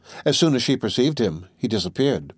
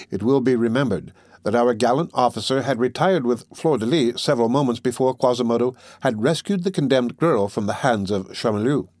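ordinary delivery, nothing marked

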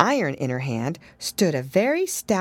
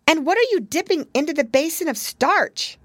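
put-on little girl; 10 semitones higher than usual. A narrator's reading. Lucy voiced.

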